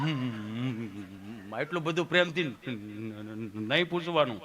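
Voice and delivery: low, hesitant objector; hum, then object in a low voice; Gabho. Acted. Patient objecting to the nurse's sweetness, low and halting. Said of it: "અહીંયા ગભો બેઠો હતો".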